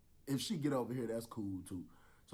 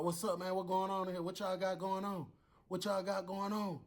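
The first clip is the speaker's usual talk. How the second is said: demanding lieutenant; 10 semitones higher than usual. Retold or imitated. Lieutenant voiced.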